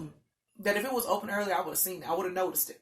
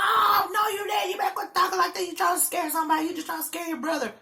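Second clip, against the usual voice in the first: put-on high-pitched mother telling off her child; scolding mama; 9 semitones higher than usual. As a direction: imitate an exasperated mama scolding. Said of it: "She's like".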